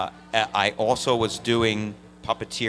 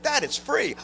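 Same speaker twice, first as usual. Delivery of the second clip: much higher, innocent little voice; naive kid. Retold or imitated. Son telling his angry father the calls cost nothing, innocent squeak. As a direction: chirp it like a clueless kid. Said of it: "I stupidly said".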